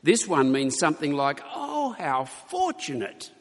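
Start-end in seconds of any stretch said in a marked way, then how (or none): 1.5-3.3 high, slow delighted exclamation. Shown as a demonstration. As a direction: gush it out like a cry of delight, slowly. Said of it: "it implies an exclamation mark".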